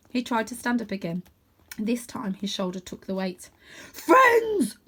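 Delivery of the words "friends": loud high barked word. A narrator's reading. Dog barking with joy at his new friend, loud. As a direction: bark it out joyfully. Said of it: "barked Cludge".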